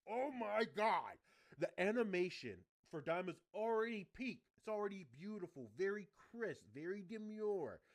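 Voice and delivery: loud, high exclamation of awe; real excitement. Real, not acted. Fan raving to viewers about animation, excited. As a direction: rave at full volume, high-pitched.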